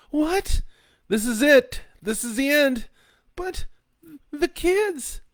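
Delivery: high, loud wife voice in dread; terrified. A narrator's reading. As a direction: gasp it as the world ends. Wife realizes the end.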